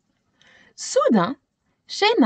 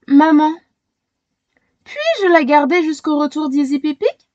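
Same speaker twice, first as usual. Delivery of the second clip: put-on slightly higher, louder girl's plea; girl pleading; 5 semitones higher, 4 dB louder than usual. Shayna begs her mother to keep the hen. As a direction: beg mum gently like a child.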